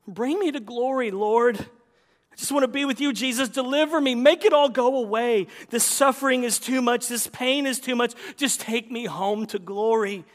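loud, high plea to God; crying out. Acted. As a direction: cry out for deliverance, acted in earnest. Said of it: "We cry out".

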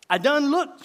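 high, loud exasperated comeback; acted country wife's retort; curt retort. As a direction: throw it back at him, fed up. Wife snaps back in story.

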